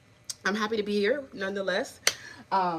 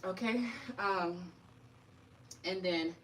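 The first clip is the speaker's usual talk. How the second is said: usual speaking voice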